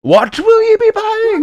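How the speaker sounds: very high, loud, drawn-out screech; manic shopkeeper. Acted. Shopkeeper asks what you'll buy, shrieking high and slow. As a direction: screech the question at the customer.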